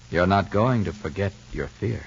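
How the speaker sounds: ordinary talk, nothing marked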